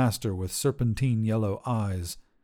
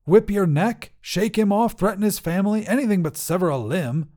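much higher, loud exasperated tirade; angry scolding; 10 semitones higher, 4 dB louder than usual. Acted. Wizard scolds the dragon, loud and exasperated. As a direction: list the options in outrage.

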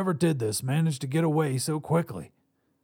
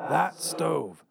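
higher-pitched raspy voice; 4 semitones higher than usual. A narrator's reading. Visitor wakes his past self, raspy. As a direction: rasp it out as an older double of yourself. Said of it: "though a bit raspy".

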